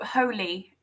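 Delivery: "Holy" said as HOH-lee; two-syllable pronunciation. Shown as a demonstration. Host suggesting how Harry's surname sounds, "Holy" in two syllables. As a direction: tentatively offer "Holy" with a final ee. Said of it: "maybe that's the pronunciation".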